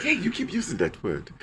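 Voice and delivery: lightly voiced quotable movie character; movie character impression. Quoting that guy from a quotable movie, lightly imitated. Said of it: "And then he's like".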